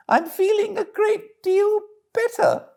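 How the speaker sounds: Toad faking weakness; feeble Toad. Acted. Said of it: "said Toad in a feeble voice".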